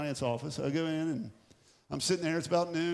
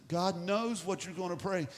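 ordinary delivery

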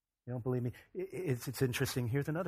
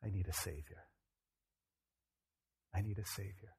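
low, soft, penitent prayer; hushed, humble plea for rescue; simple confession; 4 semitones lower, 6 dB quieter than usual. Shown as a demonstration. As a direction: admit it quietly to God, leaving long pauses. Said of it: "all we need to do is say".

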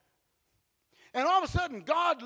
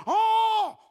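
long, loud, high "oh"; hammed-up animal cry of alarm; donkey's cry; 9 semitones higher, 6 dB louder than usual. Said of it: "donkey just goes".

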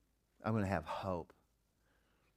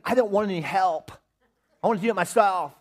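lightly voiced defiant child, higher and louder; stubborn kid; 11 semitones higher, 11 dB louder than usual. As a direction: whine like a kid who wants it his way. Son refusing help.

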